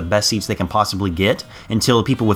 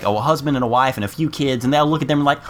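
ordinary delivery

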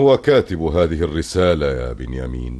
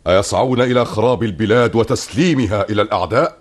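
outraged; 8 semitones higher, 7 dB louder than usual. Acted. Ruler outraged at treason plot.

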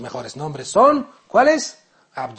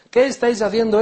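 higher, louder desperate plea; anguished cry; 7 semitones higher, 6 dB louder than usual. Acted. Abu Huraira crying out to the companions pressing his neck, loud. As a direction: cry it out from the ground.

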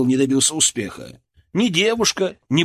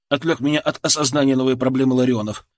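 ordinary delivery, nothing marked

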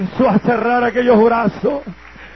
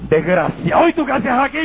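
mother's indignant scolding, pitched higher; angry mother; 6 semitones higher, 4 dB louder than usual. Retold or imitated. Old mother scolding her son at the door, indignant. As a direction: snap at the unwelcome son like an outraged mother.

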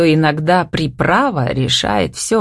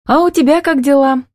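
mildly timid, high-pitched question; 10 semitones higher, 3 dB louder than usual. A narrator's reading. Sister asking brother how things are, timid. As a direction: ask shyly, pitched up. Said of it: "робко поинтересовалась она".

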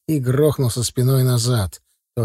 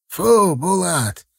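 put-on old man's drawn-out, higher command; dog owner's shout; 4 semitones higher, much slower than usual. A narrator's reading. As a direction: call the dog off, stretching each word. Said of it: "под криком своего хозяина".

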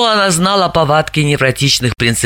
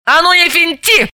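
barked; 10 semitones higher than usual. A narrator's reading. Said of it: "рявкнула Заматаева".

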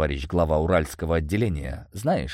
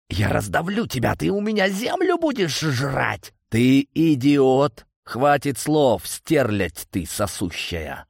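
enraged threat; 7 semitones higher than usual. A narrator's reading. Villain threatening his enemy over the phone, enraged. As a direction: rage that slows to punch each insult.

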